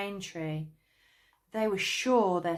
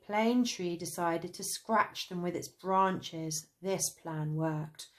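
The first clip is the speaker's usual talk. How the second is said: ordinary delivery, nothing marked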